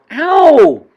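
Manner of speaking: high, loud "ow" yell; yelp of pain. A narrator's reading. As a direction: cry out as if jabbed with a pin. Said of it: "and he yelled".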